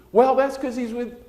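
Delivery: high mimicking reply; mother's retort. Mother retorting to the pastor, high mimicking voice. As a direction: answer back in her voice. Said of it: "And I said".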